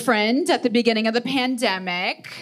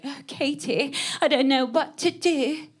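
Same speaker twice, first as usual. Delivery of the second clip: put-on higher, fussy complaining British accent; 8 semitones higher than usual. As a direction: whine to Katie in a British accent about stolen tea. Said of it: "she would always complain to me, like".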